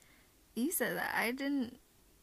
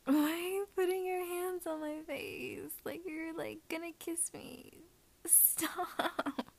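flustered high voice; 5 semitones higher, 4 dB quieter, slower than usual. Acted. Flustered, pitch up.

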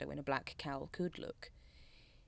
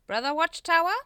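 loud, high summons; pompous cult leader demanding a reply; calling out sharply; 13 semitones higher, 13 dB louder than usual. A narrator's reading. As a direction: call the member's name high and loud. Grand Master calls on member.

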